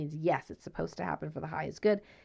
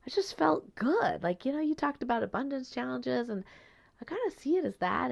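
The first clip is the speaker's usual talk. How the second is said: carefree mentee; 7 semitones higher than usual. Retold or imitated. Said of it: "He says".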